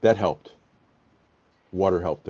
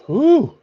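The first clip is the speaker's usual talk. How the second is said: burning whew; 6 dB louder than usual. Real, not acted. Mouth on fire from peppers.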